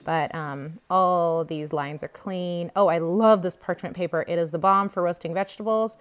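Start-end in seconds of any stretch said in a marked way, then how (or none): none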